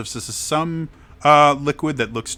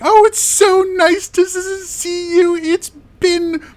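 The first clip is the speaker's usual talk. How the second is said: high, loud falsetto reproach to a loved one; gushing daughter; 6 dB louder than usual. Acted. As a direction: play an excitable woman, very high-pitched. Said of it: "She's like".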